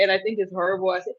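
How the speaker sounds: normal talking voice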